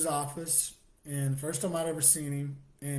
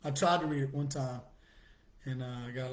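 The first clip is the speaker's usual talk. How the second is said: normal talking voice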